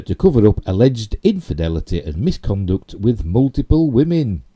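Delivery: emphatic news-story reading. Real, not acted. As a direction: punch out the key words of the report. Reads news article aloud.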